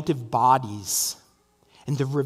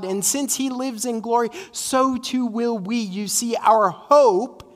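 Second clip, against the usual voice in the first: raised, higher-pitched sermon climax; impassioned preaching; 5 semitones higher, 3 dB louder than usual. Real, not acted. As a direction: preach it with building conviction, driving each phrase. Preaches at full force.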